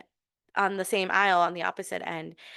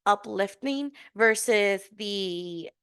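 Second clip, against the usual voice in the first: ordinary talk, nothing marked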